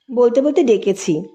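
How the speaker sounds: usual speaking voice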